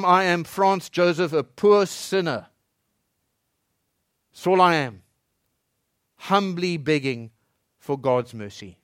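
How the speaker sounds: slow, lightly humble plea; understated humble tone; humble confession. Acted. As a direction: say it as a poor sinner begging. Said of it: "the voice would come".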